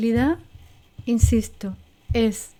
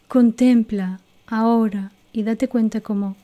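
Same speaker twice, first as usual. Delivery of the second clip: guided meditation. Acted. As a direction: lead them inward gently and unhurried. Leads a guided recall exercise.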